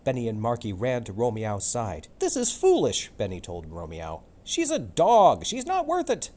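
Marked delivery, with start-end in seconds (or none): 2.2-3.1 high, loud scolding cat. Acted. Benny scolds Romeo. 4.4-6.3 loud high hissing cat. Acted. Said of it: "Marky hissed".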